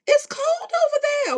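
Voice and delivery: hammed-up cry of disbelief; mock wailing shout. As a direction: wail it like a complaint. Repeats line as comic wail.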